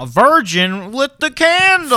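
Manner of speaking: high, drawn-out theatrical proclamation; dramatic slow-motion quote; movie line impression. Quotes the film line mockingly.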